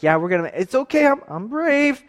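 put-on high, fast kid voice trying to be brave; a scared boy talking himself into courage; nervous brave kid. Nervous son reassuring himself.

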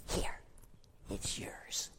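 soft whisper; whispered giving. Acted. The little boy handing his lunch to Jesus, whispered. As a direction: hand it over in a hush.

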